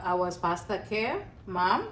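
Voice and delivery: usual speaking voice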